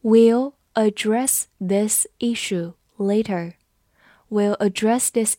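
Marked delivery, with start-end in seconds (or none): 0.0-3.5 word by word, pausing after each; slow read-along. Shown as a demonstration. Teacher leads students through the sentence, slow and separated. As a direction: take it slowly so learners can repeat. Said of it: "我们慢慢来读".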